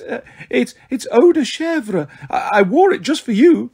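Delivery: high, nervous falsetto satyr voice. Acted. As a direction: squeak sweetly in disguise. Grover in falsetto disguise.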